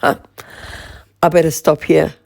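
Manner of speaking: ordinary talk, nothing marked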